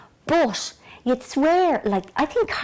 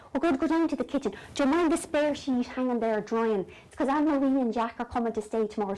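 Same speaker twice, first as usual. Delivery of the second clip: usual speaking voice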